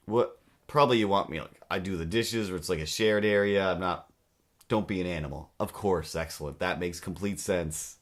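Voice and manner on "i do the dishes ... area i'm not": ordinary talk, nothing marked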